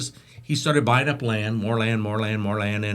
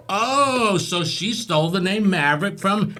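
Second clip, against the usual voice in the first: high, surprised exclamation; mock surprise; 9 semitones higher than usual. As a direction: feign shocked discovery. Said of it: "And I went".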